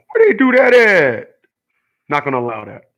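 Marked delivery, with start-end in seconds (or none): 0.1-1.4 high, loud, slow incredulous drawl of a TV pundit; Shannon Sharpe voice. Retold or imitated. Vlogger mocks critics on stream with a high, loud, slow pundit voice. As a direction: stretch it out high and loud like a stunned sports analyst. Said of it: "In my Shannon Sharpe voice".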